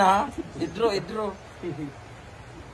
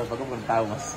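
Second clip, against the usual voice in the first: normal talking voice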